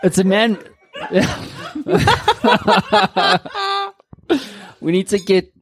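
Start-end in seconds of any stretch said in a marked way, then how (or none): 0.0-3.8 bursting into laughter; laughing. Real, not acted. Laughing at the phrase.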